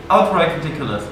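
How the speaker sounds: usual speaking voice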